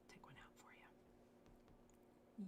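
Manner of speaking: soft, slow whisper; slow hushed whisper. Real, not acted. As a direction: barely voice it, slowly. Handling earrings on camera.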